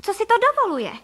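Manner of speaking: very high, loud outrage; affronted squawk of a question; indignant cry. A narrator's reading. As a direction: demand it in a high, scandalised shout. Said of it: "vykřikla paní Frejková".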